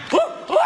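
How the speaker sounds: quick high falsetto "woo, woo"; mock the panicky squeal mid-sentence; mimicked frightened whoops. Comic picturing the man's kids, high mocking whoops.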